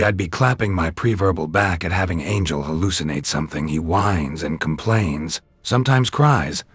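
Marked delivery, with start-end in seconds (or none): none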